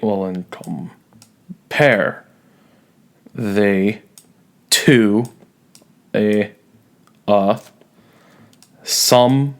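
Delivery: slow, each syllable set apart, rising on summer's; slow iambic scansion. Shown as a demonstration. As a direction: count it out beat by beat. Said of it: "All right".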